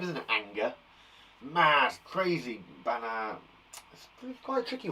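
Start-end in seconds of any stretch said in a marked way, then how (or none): none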